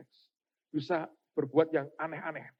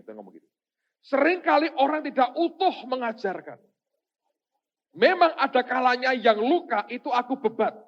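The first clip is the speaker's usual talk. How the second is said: loud, high, ringing proclamation; impassioned pulpit delivery, loud and raised; forceful preaching; 9 semitones higher, 10 dB louder than usual. Real, not acted.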